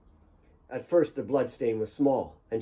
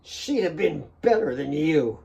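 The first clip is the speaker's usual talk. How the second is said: spewed taunt; 4 semitones higher than usual. A narrator's reading. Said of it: "he spewed".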